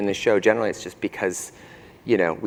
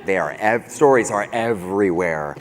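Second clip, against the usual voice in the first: normal talking voice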